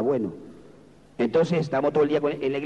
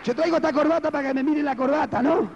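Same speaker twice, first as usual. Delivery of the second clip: high, insistent exhortation; preaching at full force; 14 semitones higher than usual. Real, not acted. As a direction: project it to the congregation, pitched high. Preaches at raised pitch. (predicación exaltada, voz aguda)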